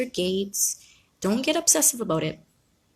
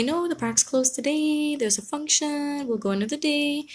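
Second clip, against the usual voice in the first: put-on higher and slower, like telling a small child kindly; gentle parent to child; 8 semitones higher than usual. Parent telling child park closed.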